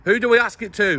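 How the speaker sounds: shouting over machinery. Real, not acted. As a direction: shout so he can hear you. Shouted exchange across camp fence.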